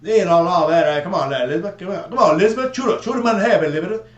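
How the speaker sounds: Cajun Swamp People imitation. Imitating the Swamp People hunter for friends, Cajun accent. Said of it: "the dude from there that talks".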